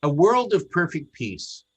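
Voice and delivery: ordinary talk, nothing marked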